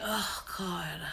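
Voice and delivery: unvoiced whisper of dread. Alone with the nosebleed, whispered dread. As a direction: breathe it out in a whisper. Alone, panicking over bleeding nose.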